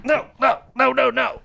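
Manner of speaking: high-pitched pleading no, no, no; whiny young guy refusing. Retold or imitated. Imitating the twenty-year-old refusing.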